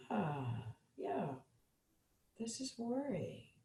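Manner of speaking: barely voiced, just name the worry; soft acknowledgment. Shown as a demonstration. Teacher modeling naming worry to parents, very soft. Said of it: "Let's say you're worried".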